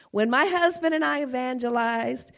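ordinary delivery

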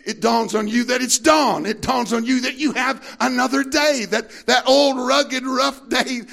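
loud, high, rising sermon climax; preaching at full force. Acted. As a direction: preach it out to the congregation with fire.

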